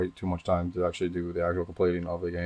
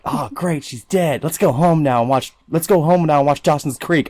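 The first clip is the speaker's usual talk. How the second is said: put-on ditzy girl voice; 8 semitones higher, 9 dB louder, slower than usual. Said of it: "they were like".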